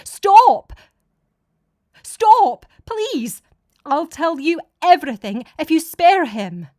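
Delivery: very high, loud pleading scream; desperate scream. A narrator's reading. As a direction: scream to save him from the beating. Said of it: "screamed out".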